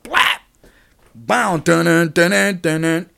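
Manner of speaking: loud, high vocal slam and entrance-music beats; wrestling sound effects. Retold or imitated. Reliving Trump's Stone Cold Stunner with loud mouth sounds. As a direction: belt out the hit and the dun-dun fanfare.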